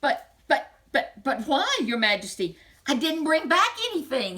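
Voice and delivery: high, loud, flustered stammer; the prince can't believe it; stammering exclamation. Acted. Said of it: "he exclaimed".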